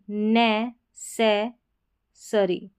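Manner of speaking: नै, से, सरी split with clear pauses; syllable by syllable. Shown as a demonstration. Teacher breaking up necessary for learners, नै, से, सरी piece by piece. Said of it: "यहाँ पर अ साउंड आ रहा है".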